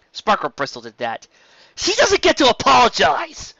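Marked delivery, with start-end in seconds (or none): 1.8-3.6 much higher, louder outburst; angry exclamation. A narrator's reading. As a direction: flare up in outrage. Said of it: "she exclaimed".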